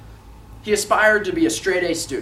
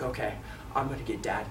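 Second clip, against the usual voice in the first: put-on low, very soft, like a plotting kid; whisper-soft mock menace, voice dropped low; sneaky scheming; 7 semitones lower, 13 dB quieter than usual. Boy behind Dad's chair plans a scare, acted sneaky hush. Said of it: "'cause he wants to scare him".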